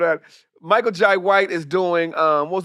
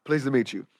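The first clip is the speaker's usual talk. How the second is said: low, softer mimicked greeting; deep voice; 4 semitones lower, 5 dB quieter than usual. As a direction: imitate a deep-voiced man, quietly. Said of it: "Deep as fuck".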